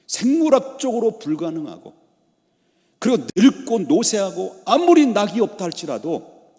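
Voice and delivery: loud, high-pitched preacher's proclamation; forceful preaching. Real, not acted. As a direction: drive each obstacle home loudly. (힘껏 외치는 설교)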